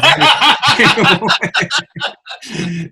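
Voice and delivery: high, loud burst of real laughter; big laugh. Real, not acted. Storyteller laughing hard at his own question.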